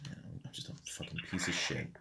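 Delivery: very soft, low, half-voiced mumble; dejected self-muttering. Man describing his bad Sundays to friends, dejected muttering. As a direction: mumble it to yourself, barely audible.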